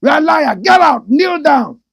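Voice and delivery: loud high yelling accusation; furious shout. Retold or imitated. Senior shouting at lying junior, furious yell. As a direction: yell him down.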